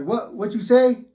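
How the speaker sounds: sharp, high, loud challenge; confrontational challenge. Retold or imitated. Officer squaring up to the inmate, high loud challenge. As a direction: bark it back at him, spoiling for a fight. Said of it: "So Nunez like".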